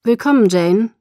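loud, formal greeting; reserved, courteous welcome; cool and polite. A narrator's reading. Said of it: "Edward sprach kühl und höflich".

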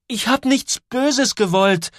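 high, slow, insistent cry; drawn-out pleading outburst; shouted protest. A narrator's reading. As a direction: insist loudly, pitched high. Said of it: "schreit Joel".

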